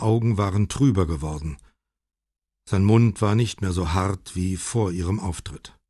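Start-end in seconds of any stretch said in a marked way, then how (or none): none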